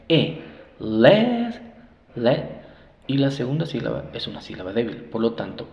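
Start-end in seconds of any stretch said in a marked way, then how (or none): 0.1-2.5 "E, le" higher and louder with short "eh"; short E sound. Shown as a demonstration. Teacher drills the short E, "E, le, le" raised and separated. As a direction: punch out "le" as a stressed short E. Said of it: "sonido corto de la E".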